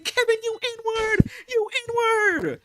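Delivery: high, slow, loud mock rant; hammed-up yelling at Kevin. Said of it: "I'm saying".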